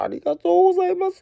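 jumps way up into a squeaky falsetto thank-you. Real, not acted. Host thanking a listener for a glowing letter, in a high squeal. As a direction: thank them in an overjoyed squeal.

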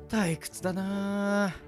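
slow, soft, drawn-out complaint; bored. Acted. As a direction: drag it out in idle boredom. Alone waiting, bored. (退屈そうに間延びした声)

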